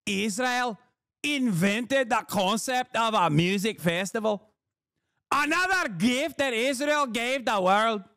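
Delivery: high, indignant Scottish parody; high-pitched Scottish caricature, stretching the words; mock Scottish accent. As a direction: lecture absurdly in a squeaky Glasgow accent. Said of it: "you doing that accent".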